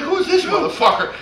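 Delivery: high, loud mock outrage; acted-out incredulous demand; indignant disbelief. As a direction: imitate someone affronted by a newcomer. Voicing rival's reaction to his swagger.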